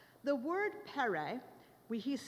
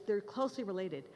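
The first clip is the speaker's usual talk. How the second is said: normal talking voice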